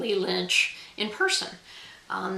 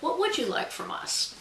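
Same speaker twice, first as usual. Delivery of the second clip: higher, sincerely asked, without any whine; sincere question; 6 semitones higher, 3 dB quieter than usual. Shown as a demonstration. Author asking readers what they want from authors, sincere. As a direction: ask it as a genuine, open question. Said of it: "in the true sense of".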